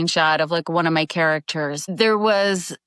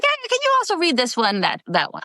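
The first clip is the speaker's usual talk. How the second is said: high-pitched, tossed-in studio ask; offhand request; 9 semitones higher than usual. Retold or imitated. Studio staff handing her an extra character, high offhand request. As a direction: imitate a producer asking a favour, pitched way up.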